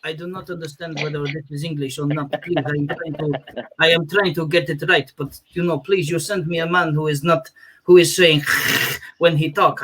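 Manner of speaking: mock Khabib lost in a Scouse interview; Khabib impression. Khabib puzzled by a Liverpool interviewer, in a Dagestani accent. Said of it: "Khabib must be sat there going".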